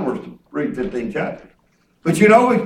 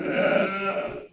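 imitating crying wife; 7 semitones higher, much slower than usual. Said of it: "she's crying".

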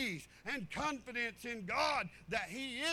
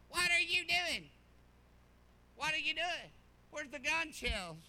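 higher, louder hammed-up nagging wife; comic imitation of an upset wife; mock wife voice; 5 semitones higher, 5 dB louder than usual. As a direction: play the wife as a funny cartoon. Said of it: "She don't talk like that. I like to make it sound funny".

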